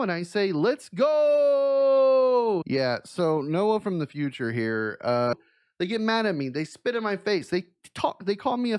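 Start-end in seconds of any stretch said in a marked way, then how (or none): 0.5-2.6 loud, high, drawn-out "Let's go"; pumped-up meme shout; hyped shout. Acted. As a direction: stretch the "go" out like a hype call. Hyped reply to friends.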